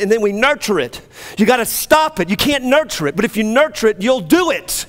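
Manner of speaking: high, loud, driving sermon voice; full-force revival preaching; fervent preaching. Acted. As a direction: preach it hard to the congregation.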